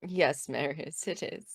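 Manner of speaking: hushed reply to a sore head; quiet. Acted. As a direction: keep it soft for a hangover. Quiet reply to hungover friend.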